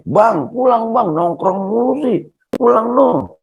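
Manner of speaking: high-pitched, drawn-out wifely nagging call. Acted. Wife nags husband among his friends.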